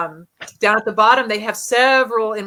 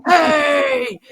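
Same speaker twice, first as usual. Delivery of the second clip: high, loud "hey" called out; mock shouted interjection; imitated male ghost shout; 7 semitones higher, 5 dB louder than usual. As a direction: holler it like the voice on the recording. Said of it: "you hear this male voice go".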